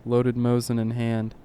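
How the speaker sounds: ordinary delivery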